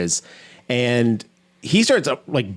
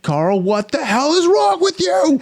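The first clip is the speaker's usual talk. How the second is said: high, slow, alarmed shriek; startled nurse shrieking at the creepy patient; alarmed cry; slower than usual. Retold or imitated. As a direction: call out to him in shock, voice shooting up.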